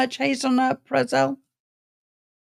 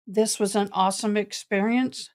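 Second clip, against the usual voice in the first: ordinary delivery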